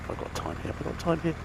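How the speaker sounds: quick quiet mutter, not for the camera; muttering to himself. Real, not acted. Rider mutters to himself at lights.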